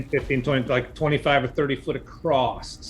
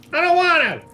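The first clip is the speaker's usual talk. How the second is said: high, loud whiny yell back; refusing missus; 7 dB louder than usual. Acted. Spider wife refuses her husband, high loud yell. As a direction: yell a stubborn refusal from afar.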